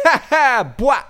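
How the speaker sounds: high, loud cackling burst; playful cackle. Acted. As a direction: cackle like a hype man. Playful DJ sound.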